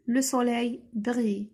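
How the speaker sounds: le soleil brille said very slowly, word by word; on lit «le soleil brille» très lentement, mot par mot; very slow dictation. Shown as a demonstration. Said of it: "first reading, very slow". (lecture très lente, mot à mot)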